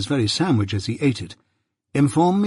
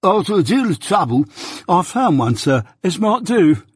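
higher, snuffly, stuffed-up voice; voice with a cold; 7 semitones higher, 3 dB louder than usual. A narrator's reading. Mike reporting to Jaggers, snuffly with a cold. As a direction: talk through a blocked nose. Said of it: "in the voice of a sufferer from a constitutional cold".

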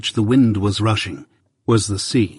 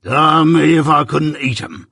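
higher, louder menacing growl; threatening convict; 4 semitones higher, 3 dB louder than usual. Acted. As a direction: scare the boy half in jest. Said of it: "threatening shake of his head".

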